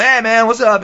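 high, hearty entrance greeting. Retold or imitated. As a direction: burst in with a high-pitched hello. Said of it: "Grohl himself walks in, just going".

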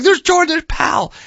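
high, excited recognition of a friend on TV; excited shout. Retold or imitated. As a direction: point at the screen in disbelief. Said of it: "I'm like".

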